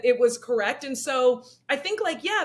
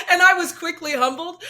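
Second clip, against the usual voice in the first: normal talking voice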